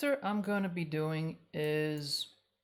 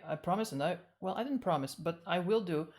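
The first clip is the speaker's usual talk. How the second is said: ordinary delivery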